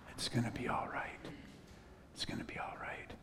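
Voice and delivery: hushed, unvoiced reassurance; comforting whisper. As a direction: whisper it into a crying stranger's ear. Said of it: "I just whispered that in her ear".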